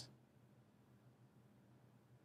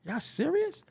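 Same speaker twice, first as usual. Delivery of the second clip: incredulous; 12 semitones higher, 6 dB quieter than usual. Acted. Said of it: "you be like".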